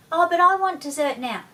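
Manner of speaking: put-on high, loud little-kid whine; whining child. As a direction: nag for dessert right now. Child pleads against parent.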